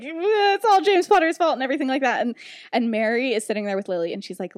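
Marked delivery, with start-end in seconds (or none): 0.0-1.5 high, mocking whine; whiny blaming. Snape blames James to the teachers, high and whiny. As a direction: imitate a whiny tattletale. Sulky accuser blames rival.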